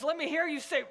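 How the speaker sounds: ordinary talk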